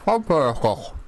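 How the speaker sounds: safe word mumbled through a mouthful of grapes; mouth full. Shown as a demonstration. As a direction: say it with your mouth stuffed full. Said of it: "try and say a safe word".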